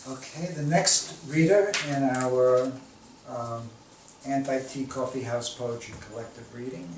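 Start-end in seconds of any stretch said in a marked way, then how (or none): none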